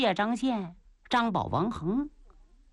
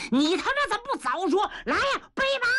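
high furious bellow, fast; furious shout; 10 semitones higher, faster than usual. Acted. Father slaps son and yells for his horse, furious shout. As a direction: roar it and call for the horse. 伸手照着牛七一个大嘴巴子.

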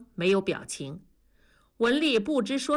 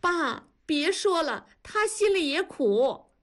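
much higher, drawn-out anguished plea; tearful cry; 9 semitones higher than usual. A narrator's reading. Daughter pleading with father for her husband, crying out. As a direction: cry out slowly, begging him to stop. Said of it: "南方就哭着喊".

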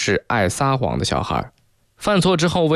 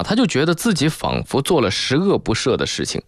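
ordinary talk, nothing marked

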